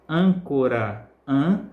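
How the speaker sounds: âncora slowly as ÂN-co-ra, then ân alone; stressed syllable demo. Shown as a demonstration. Teacher showing the strongest syllable, âncora drawn out with ÂN stressed. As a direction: lean on ÂN in a slow âncora, then isolate ân. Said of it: "é a sílaba mais forte".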